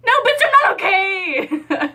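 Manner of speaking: hammed-up tearful retort to the boyfriend; laughing, tearful outburst. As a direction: blurt it out half laughing, half crying.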